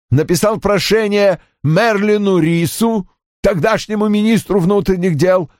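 put-on high-pitched, emphatic older father; Roy's agitated character voice. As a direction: play an indignant father, pitched well up.

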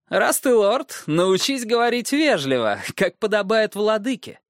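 high, sly and condescending; sly dwarf voice. Acted. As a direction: lecture the boy lord mockingly. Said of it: "отвечал коротышка". (ехидный высокий голос карлика)

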